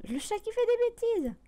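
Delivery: high, sing-out remark about the cat; cat misbehaving. Real, not acted. Streamer remarking on the cat's mischief, high-pitched. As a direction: lift the voice right up at the naughty cat.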